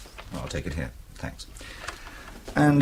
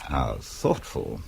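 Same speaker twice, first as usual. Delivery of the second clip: dry irony; 10 semitones lower, 4 dB quieter, much slower than usual. Acted. Ironic reply to spy's offer.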